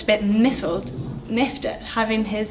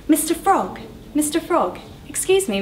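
calling out; 7 semitones higher than usual. Acted. Scorpion calling to the frog.

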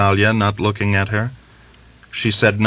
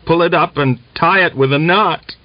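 raised pitch, a contained shouted order; mild narrated shout; 8 semitones higher than usual. A narrator's reading. Soldier ordering his comrade at the crossbeam, mildly shouted. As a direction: call it up without full volume. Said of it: "and shouted".

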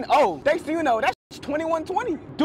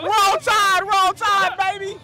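taunting shout; 6 semitones higher, much slower than usual. Real, not acted. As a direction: jeer it across the crowd. Heckling a rival fan.

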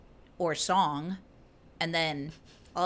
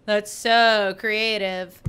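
loud, slow sarcastic praise; mock contempt; 3 semitones higher, 7 dB louder, much slower than usual. Tolkien sneering at a rival writer, sarcastic praise. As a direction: drag out the fake compliment. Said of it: "thought his stories were, like, such baby shit".

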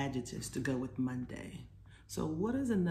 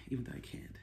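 whisper; 4 semitones lower, 7 dB quieter, much faster than usual. Real, not acted.

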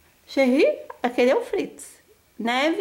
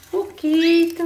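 ordinary talk

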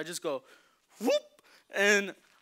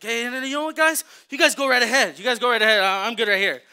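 much louder, high mock graciousness; fake generous; 6 semitones higher, 13 dB louder than usual. Staying behind while waving group on, overdone generosity. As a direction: pretend you're doing them a favor. Pretending to give them opportunity.

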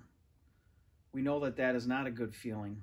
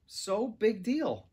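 slow, dismissive and higher; 6 semitones higher, 4 dB louder, much slower than usual. A narrator's reading. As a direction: shrug it off. Kids arguing, dismissive retort.

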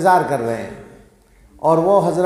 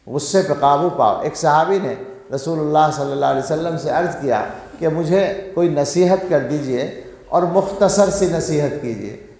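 ordinary talk, nothing marked

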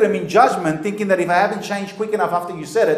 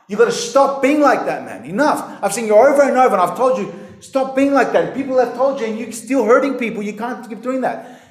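stern, higher-pitched telling-off; harsh rebuke; 4 semitones higher than usual. Acted. Preacher acting out confronting someone who keeps hurting people, harsh. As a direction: confront them forcefully, as if fed up. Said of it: "harshly tell someone enough".

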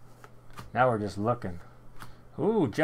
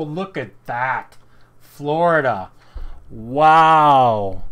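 long, loud wow; amazed shout; 13 dB louder, much slower than usual. Real, not acted. Card breaker seeing a Florida patch card, amazed shout. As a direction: belt it out in amazement.

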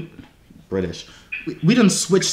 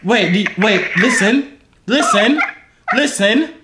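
higher-pitched mock British accent; British accent, pitched up to show it off; put-on British accent; 7 semitones higher, slower than usual. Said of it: "Do y'all like my accent".

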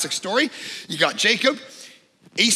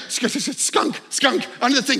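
stammered skunk alarm, pitched up; panicked daughter; 4 semitones higher than usual. Retold or imitated. Daughter runs inside to report a skunk, mock panicked stammer. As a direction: stutter the word out in a mock panic. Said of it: "She runs inside, she goes".

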